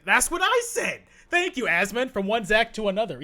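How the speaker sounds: loud, high excited exclamation; real excited yell of agreement; vindicated outburst. Real, not acted. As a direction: shout it, thrilled to be backed up. Delighted that streamer agrees with him.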